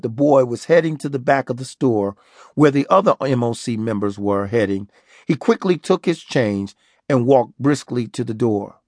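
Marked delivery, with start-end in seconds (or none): none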